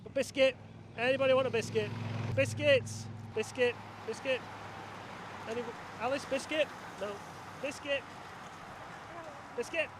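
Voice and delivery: high, sing-song vendor's call. Acted. Presenter offering biscuits to passing riders, high calling voice. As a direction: pitch it up like offering treats to a crowd.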